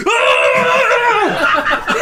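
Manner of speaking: high loud exasperated "Ah!"; frustrated shout. Retold or imitated. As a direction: yell out in exasperation. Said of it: "he's just like".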